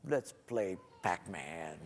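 low, soft, drawn-out invitation; humbled offer. Retold or imitated. Dad making up with his son, low and slow. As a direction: say it very slowly, voice dropped.

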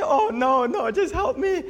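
very high, laughing fake terror; mock panicked girl. Girl on plane clinging to pastor, hammed-up panic. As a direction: squeal it like she's about to die. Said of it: "And she".

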